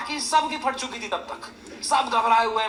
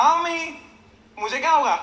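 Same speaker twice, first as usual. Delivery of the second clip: higher, louder fake-cheerful reassurance; put-on brave voice; 5 semitones higher, 5 dB louder than usual. Son faking cheer on the phone so his worried mother won't know he cried, bright and brushing it off. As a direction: brush it off like nothing happened.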